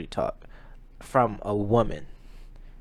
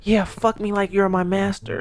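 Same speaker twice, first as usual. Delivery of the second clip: high-pitched put-on lover's voice; mock lover; 9 semitones higher than usual. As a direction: imitate a woman in bed, pitched up. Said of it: "They get racist".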